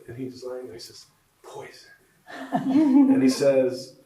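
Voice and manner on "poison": barely audible sickbed murmur; very soft. Acted. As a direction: let the word out faintly, like a sick man. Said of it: "very softly says".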